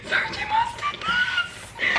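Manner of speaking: very high, slow, laughing squeak; real overwhelmed squeal. Real, not acted. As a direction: squeak it out in disbelief. Overcome by the book's ending.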